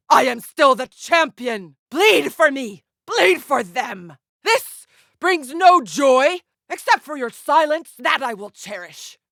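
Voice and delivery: high, forceful, drawn-out cries; defiant battle fury. Acted. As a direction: claim victory over the opponent mid-fight. Defiant champion fighting on.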